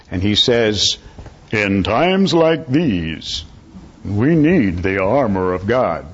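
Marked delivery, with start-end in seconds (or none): 1.5-6.1 slow, drawn-out bass-baritone announcer impression; imitated masculine baritone, stretched out grandly; Bronson. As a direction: intone it like a deep-voiced program announcer. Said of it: "masculine bass baritone voice of his".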